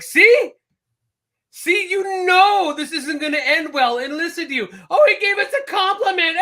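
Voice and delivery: shrill mock of the clapping women; mocking women as Bill Burr. Comedian mocking the cheering women, in a high put-on voice. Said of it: "He's like".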